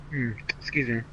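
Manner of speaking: ordinary talk, nothing marked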